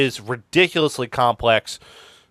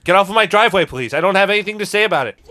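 shouting at trespasser; 6 semitones higher, faster than usual. Retold or imitated. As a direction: yell it at them, then add please. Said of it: "'cause he's still strikingly Canadian".

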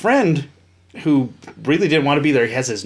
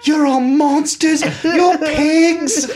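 high, drawn-out mock British wail; hammed-up British hysterics, slow and high; Marina Sirtis, crying; 13 semitones higher, 3 dB louder, much slower than usual. Actress berating fans over the baby, mock British wail. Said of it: "she's got the British accent".

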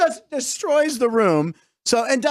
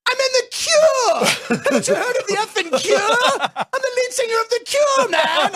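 indignant high-pitched yell, drawn out; hammed-up offended rock singer shouting; slower than usual. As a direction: wail it out in comic outrage, far above your usual pitch. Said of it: "so he yelled at him".

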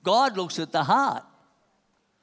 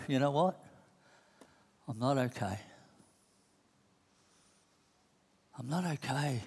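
softer, lower mock confession "I'm not okay"; quiet confession; 6 semitones lower, 9 dB quieter than usual. A struggling person admits it to himself, quiet. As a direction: let it slip out quietly.

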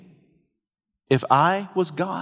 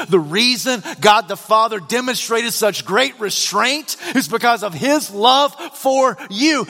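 raised, driving pulpit delivery; fervent preaching; 8 semitones higher than usual. Real, not acted. Preacher telling sinners of God's love, fervent preaching. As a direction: press each phrase home to the congregation.

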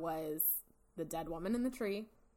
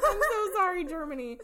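laughing; 10 semitones higher, 6 dB louder than usual. Real, not acted. Laughing at own pronunciation.

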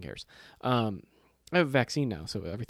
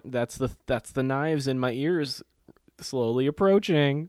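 slow, building, louder by the end; mock dread; 3 semitones higher, 6 dB louder than usual. Podcaster likening the accent to Jurassic Park's approaching threat, mock dread. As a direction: draw it out like approaching doom, hammed up. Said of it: "I'm like".

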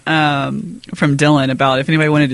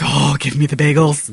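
eager craving. Retold or imitated. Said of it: "and I'm like".

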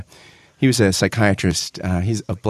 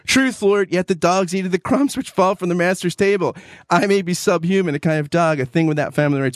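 voiced pleading woman; 8 semitones higher than usual. The woman's plea within reading.

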